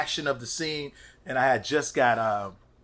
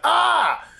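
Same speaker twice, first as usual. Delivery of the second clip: short, high scream demo; retold scream; 4 dB louder than usual. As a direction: let out a quick imitation scream. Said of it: "every time I scream".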